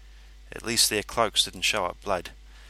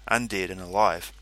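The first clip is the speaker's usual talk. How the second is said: usual speaking voice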